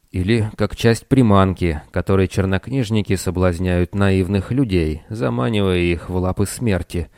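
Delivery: ordinary delivery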